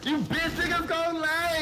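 high, stretched-out hype read; high energy. Shown as a demonstration. Streamer finishing the donation on demand, high energy. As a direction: punch it up high and draw it out. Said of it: "Say it with energy".

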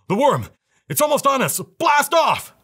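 very high, urgent cry; panicked warning, pitch leaping up; gasping alarm. A narrator's reading. Last man aboard warns of the worm, gasping alarm. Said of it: "The last man came gasping".

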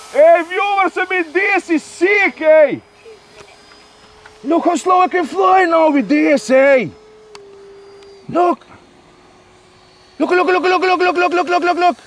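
high, loud excited shouting to viewers; a rapid, eager look look look; thrilled. Real, not acted. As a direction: show off the plane, bursting with excitement. Thrilled by his plane's slow flight.

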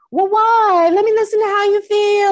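loud, high singsong coddling; hammed-up sympathetic listener; mock sympathy. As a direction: play up exaggerated concern in a high voice. Mock version of sympathy she didn't get.

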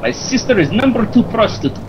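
high, excitable mock Kazakh accent; comic foreign accent, pitched well up; Borat. Friend doing a film character, high mock Kazakh accent. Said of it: "He's Borat".

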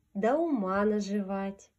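plain reading voice

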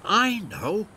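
bright high eager burst; a little engine with a sudden idea; excited. A narrator's reading. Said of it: "puffed Thomas excitedly".

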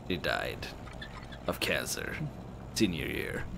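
very low and quiet; halting, heavy, broken into short phrases; grieving friend. Acted. Friend explaining mother's death, low halting grief.

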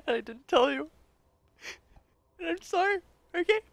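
high, squeaky mock-weepy confession; acted tearful apology. Character apologizing to Wraith for keeping a secret, mock tearful. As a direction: break into a pretend weepy apology.

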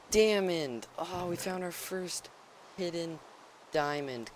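thrilled loud discovery shout; excited. Real, not acted. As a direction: burst out with delight. Finds first hidden diamond.

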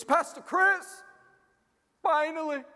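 high-pitched, slow gushing fan; mock-excited high female voice; excited young woman. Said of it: "this young lady comes up to me. She goes".

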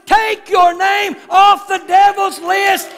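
shouted, high and slow preaching; full-force preaching. Real, not acted. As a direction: preach it out at the top of the voice.

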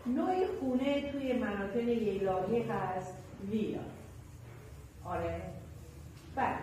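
usual speaking voice

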